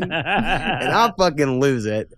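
slow words broken by real laughter; laughing. Real, not acted. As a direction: lose it laughing as you talk. Laughing at the nerd's joke.

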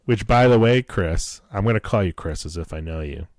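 ordinary talk, nothing marked

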